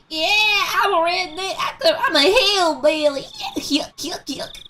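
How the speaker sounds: mock hillbilly drawl, high and stretched, ending in yuks; thick Southern accent. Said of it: "thick their Southern accents were".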